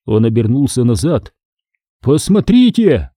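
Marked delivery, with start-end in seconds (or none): 2.0-3.1 high, drawn-out call; crying out. A narrator's reading. Greg alerting friends to the window, crying out. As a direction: call out slowly at a high pitch. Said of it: "закричал он".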